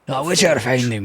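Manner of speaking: a hammed-up Scottish accent try; Scottish accent attempt. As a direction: mimic the game character in a shaky Scottish accent. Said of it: "That's what it sounds like in my head".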